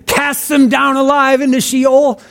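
high, forceful declaimed curse; David's severe prayer. Acted. Preacher quotes David asking God for justice, forceful declaiming. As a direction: hurl it out as a high-pitched plea for judgement. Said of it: "the kinds of things that David asked here".

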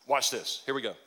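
ordinary delivery

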